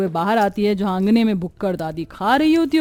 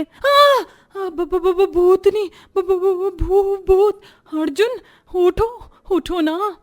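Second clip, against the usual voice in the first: screaming, stammering in fright, much higher; terrified scream; 9 semitones higher, slower than usual. Acted. Piyu wakes Arjun, screaming in terror. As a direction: shriek and stutter as if seeing a ghost. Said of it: "चीख पड़ती है".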